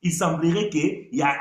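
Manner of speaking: ordinary talk, nothing marked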